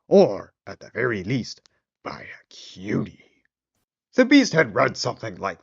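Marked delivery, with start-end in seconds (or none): 2.0-3.4 whispering; whispered aside. A narrator's reading. Narrator aside.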